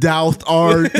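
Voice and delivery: lightly hammed old English Renaissance accent; mock Renaissance faire accent. Co-host asks him to do his Renaissance festival accent, mock old English. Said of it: "Do the accent".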